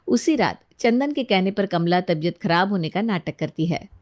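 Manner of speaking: usual speaking voice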